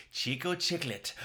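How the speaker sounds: ordinary delivery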